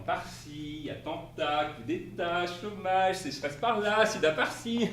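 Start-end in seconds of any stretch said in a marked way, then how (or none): none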